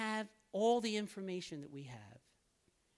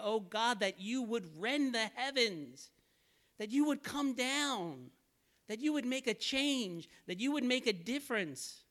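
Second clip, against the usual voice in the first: higher, earnest pleading cry to God; pleading prayer; 5 semitones higher, 3 dB louder than usual. Retold or imitated. Preacher echoing Isaiah's plea to God, lifted pleading. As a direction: beg God to come down, voice lifted. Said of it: "I come back to pleading like Isaiah".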